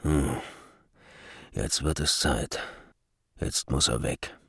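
low murmur to himself; muttering. A narrator's reading. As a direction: mutter it under your breath. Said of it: "wie dieser murmelte".

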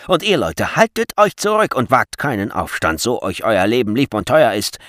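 loud, forceful guard's threat; shouted warning. A narrator's reading. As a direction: call out to hold back a crowd. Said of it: "rief der Wächter Frieshart".

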